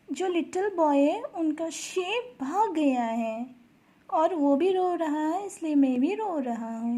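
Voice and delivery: high, loud storybook lament; crying rabbit, acted. Rabbit telling the squirrel why it cries, high mock-upset voice. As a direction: wail it lightly in a high voice, without sobs.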